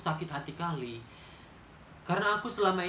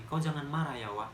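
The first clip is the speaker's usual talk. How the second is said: friend warning softly; 3 semitones lower, 6 dB quieter than usual. Retold or imitated. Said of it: "Katanya gini".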